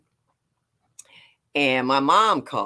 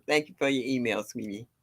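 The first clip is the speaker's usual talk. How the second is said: ordinary talk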